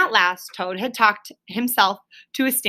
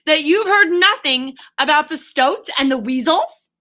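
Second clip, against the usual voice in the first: shout; 7 semitones higher, 3 dB louder than usual. A narrator's reading. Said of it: "shouted Rat".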